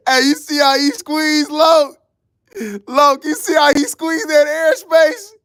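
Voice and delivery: excited laughing hype. Real, not acted. Laughing hype over a replay clip.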